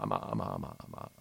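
a choked, spluttering stammer; trembling sputter. A narrator's reading. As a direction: sputter in disgust and shock, dropping low. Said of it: "fremeva di un misto tra disgusto e sorpresa".

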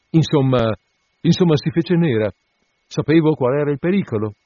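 agitated emphasis. A narrator's reading. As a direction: presses the bad news hard. Surgeon emphatically recounting. (racconto concitato, più acuto e forte)

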